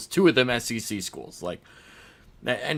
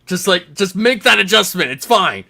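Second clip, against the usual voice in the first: loud, higher-pitched pleading at a player; exasperated plea; 7 semitones higher, 6 dB louder than usual. Acted. Fan pleading with the quarterback to adjust, loud and exasperated. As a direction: yell advice at the TV, exasperated. Acted plea continues after interjection.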